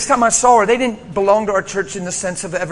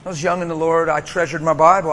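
ordinary talk

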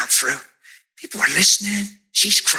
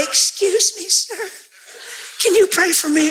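polite little lady; 8 semitones higher than usual. Retold or imitated. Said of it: "A little lady comes".

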